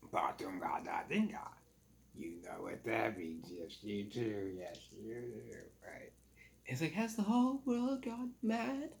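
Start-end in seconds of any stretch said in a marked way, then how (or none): 0.1-3.5 put-on sly old sailor. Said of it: "He's like, um". 3.5-4.5 put-on sly old sailor. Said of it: "He's like, um". 4.6-5.6 sly echo, softer. Retold or imitated. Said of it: "He's like, um". 6.9-9.0 put-on high, slow New England society accent. Nathaniel aghast at the sailors, high slow New England society accent. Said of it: "he actually gets the accent of that movie".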